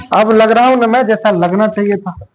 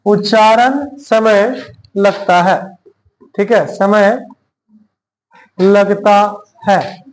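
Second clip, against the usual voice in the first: very slow, spaced-out dictation; much slower than usual. Shown as a demonstration. Teacher dictating notes word by word. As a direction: spell it out slowly for notes. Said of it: "dictating slowly to class".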